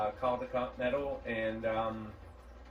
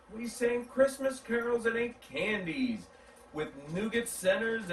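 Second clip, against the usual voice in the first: story narration; 6 semitones higher than usual. A narrator's reading. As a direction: read it like a bedtime story to children. Reads a picture book aloud.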